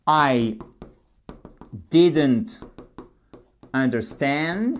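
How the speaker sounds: slow teacher dictation with long pauses between words; spaced-out, careful English; word-by-word model. Shown as a demonstration. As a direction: say it one word at a time for learners.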